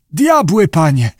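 high, terrified wail; panicked diver wailing to his master; moaning in terror. A narrator's reading. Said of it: "zajęczał Syngales".

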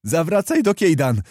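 high, loud command; shouted order. A narrator's reading. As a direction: call out the order in agitation. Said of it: "krzyknął Kowalski". (wykrzyczany rozkaz)

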